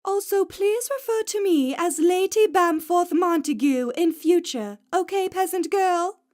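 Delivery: high, loud, haughty aristocrat talking down; hammed-up snooty lady of the manor; posh lady voice. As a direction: lord it over the peasant girl. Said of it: "I continue joking".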